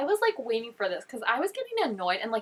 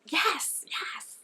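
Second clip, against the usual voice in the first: whisper; 8 semitones higher than usual. Real, not acted. Cheering on Charlotte.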